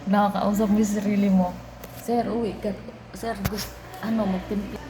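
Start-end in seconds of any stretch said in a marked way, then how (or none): 2.0-4.8 soft, hushed plea to the boss; quiet pleading aside to sir. Retold or imitated. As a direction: murmur it so the boss won't hear. Whispered lines to the boss.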